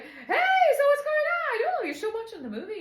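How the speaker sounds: high, loud, over-bright hello; hammed-up chirpy greeting; mock perky greeting. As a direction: pop in wide awake, pitch way up. Acts out waking refreshed from nap.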